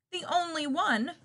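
usual speaking voice

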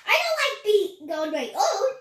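loud complaint; grumbling. Acted. Keeper alone at bedtime, loud grumbling. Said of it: "he grumbled".

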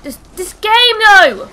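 high, very loud exasperated shout; frustrated yell. Real, not acted. Gamer who hates their life rages at the game, frustrated yell. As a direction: build to a real yell of frustration.

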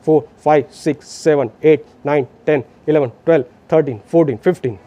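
low, steady rhythmic count; counting aloud. Shown as a demonstration. Rescuer counts to fifteen so partner can prepare breaths, aloud and rhythmic. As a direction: count each compression out in an even beat. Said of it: "मैं ज़ोर से बोल रहा हूँ".

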